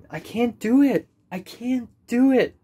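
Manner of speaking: high, loud panicky repeat; fretting out loud, voice up high; real anxious outburst; anxious cry. Real, not acted. Anxious outburst about talking to Mary.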